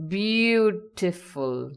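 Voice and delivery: "beautiful" very slow, with a long "beau"; stress on first syllable. Shown as a demonstration. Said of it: "The stress is on beau".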